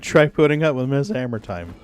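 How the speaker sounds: ordinary delivery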